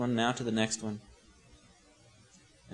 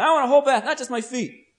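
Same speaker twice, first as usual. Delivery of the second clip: high, loud demand easing at the end; extreme eagerness; 8 semitones higher, 5 dB louder than usual. Acted. Peter swings to the other extreme, over-eager. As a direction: demand it with comic overkill. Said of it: "he's extreme on the other end".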